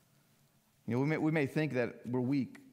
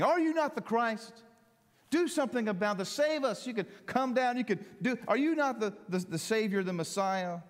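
railing criminal; 9 semitones higher than usual. Acted. A criminal on the cross taunting Jesus, high jeering. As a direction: sneer up at him from the cross. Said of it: "railed at him".

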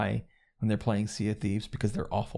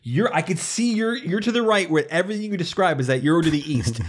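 louder, higher mock argument; retold yelling; 6 semitones higher, 5 dB louder than usual. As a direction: replay a friendly shouting match. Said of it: "we were, like, yelling at each other".

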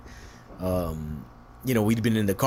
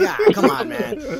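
much higher and louder, laughing through it; laughing coax; 6 dB louder than usual. Real, not acted. Urging Pouch to play along, laughing high. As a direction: egg him on through a laugh. Coaxing friend while laughing.

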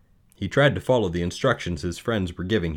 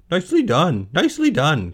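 high, loud goose cheer; cheering high-pitched bird voice; goose crying out; 9 semitones higher, 7 dB louder than usual. Acted. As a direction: squawk praise like an excited goose. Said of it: "cried the goose".

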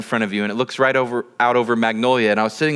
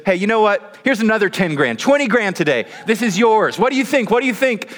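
raised, eager YouTuber pitch; excited hype; 7 semitones higher than usual. Retold or imitated. YouTuber offering more cash to get a reaction, excited high-pitched hype. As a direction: imitate a prankster building up the excitement.

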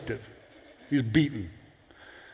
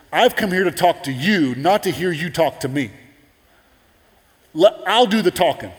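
stern rebuke; 3 semitones higher, 5 dB louder than usual. Acted. Gabriel rebukes Zacharias.

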